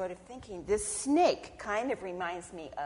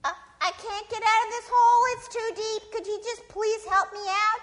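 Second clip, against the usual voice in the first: high, loud whine; pleading snake; 13 semitones higher, 10 dB louder, faster than usual. Acted. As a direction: beg whiningly, pitched way up.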